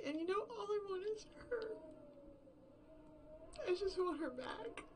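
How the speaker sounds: high, cracking voice on the edge of tears; fast and choked up, holding back sobs; pleading for her back as his voice breaks; voice breaking. Acted. Breaks down over lost partner.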